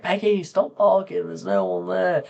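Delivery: high exasperated call to the dog; retold telling-off, pitched well up; annoyed calling out. Acts out scolding the barking dog.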